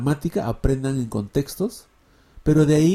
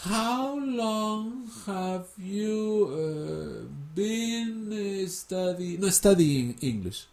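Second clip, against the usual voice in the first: very slow, higher English full of uh pauses; hammed-up stiff textbook English speaker; slow halting English; 5 semitones higher, 5 dB quieter, much slower than usual. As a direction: mimic a learner building each sentence word by word. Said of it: "¿quién habla así?".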